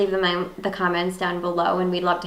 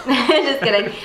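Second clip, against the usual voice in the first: laughing; 2 semitones higher, 4 dB louder, slower than usual. Real, not acted. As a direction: laugh it off slowly. Laughing retraction.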